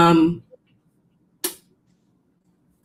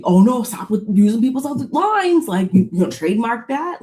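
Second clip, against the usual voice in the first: mock indignant; 6 semitones higher than usual. [name] mocking Brittany's borrowed line.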